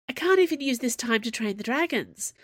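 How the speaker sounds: complaining, pitch pushed up; groaned complaint. A narrator's reading. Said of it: "groaned Finn".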